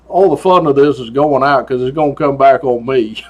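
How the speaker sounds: normal talking voice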